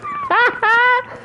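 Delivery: high-pitched burst of laughter. Real, not acted. Streamer laughs at his own soda joke.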